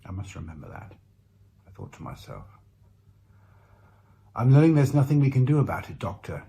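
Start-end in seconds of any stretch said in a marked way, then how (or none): 4.3-6.4 pragmatic. A narrator's reading. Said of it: "Jade said in a way that was so pragmatic".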